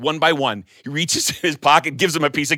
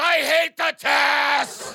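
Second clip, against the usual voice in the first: slow shout; 11 semitones higher, much slower than usual. Acted. Principal's candy-crazed outburst.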